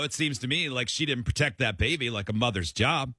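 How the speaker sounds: ordinary delivery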